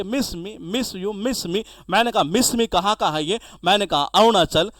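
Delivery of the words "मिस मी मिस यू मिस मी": mock tender. Husband tells wife he'll miss her.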